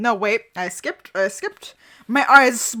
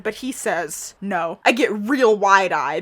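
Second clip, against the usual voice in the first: ordinary delivery, nothing marked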